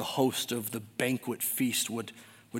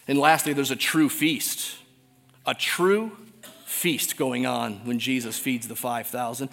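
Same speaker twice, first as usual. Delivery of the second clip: ordinary talk, nothing marked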